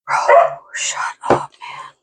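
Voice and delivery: hushed whispered complaint. Real, not acted. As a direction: whisper it under your breath. Whispering so dog stays calm.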